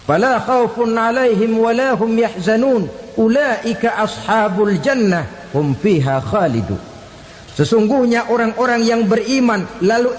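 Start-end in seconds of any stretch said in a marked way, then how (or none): 0.0-6.8 raised, sustained reciting tone; declaimed scripture, higher than talk; Quran recitation. Acted. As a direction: recite the verse in measured Arabic phrases. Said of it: "Kata Allah".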